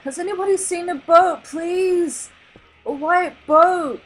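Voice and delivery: high, loud pleading call; desperate calling out. A narrator's reading. Father begging passing fish, loud high plea. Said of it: "He called to a school of passing fish".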